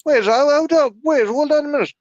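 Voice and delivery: high, loud incredulous sputter; can't believe what he's hearing; outraged disbelief. Real, not acted. Hearing [name] replaced [name], high outraged disbelief.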